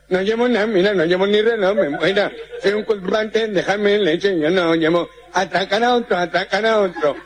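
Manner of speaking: muddled consonants, llevo as jemo; nasal-speech character. Acted. The gangoso protesting to muggers, consonants blurred. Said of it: "Un gangoso".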